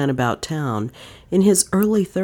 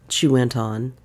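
ordinary delivery

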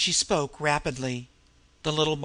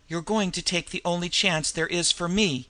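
ordinary delivery, nothing marked

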